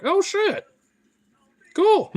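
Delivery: sudden high squeal of surprise; surprised. Retold or imitated. Streamer retelling finding forgotten weed, high surprised squeal. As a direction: yelp it out, way up high. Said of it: "I was like".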